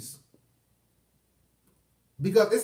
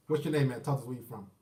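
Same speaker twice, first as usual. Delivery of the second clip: normal talking voice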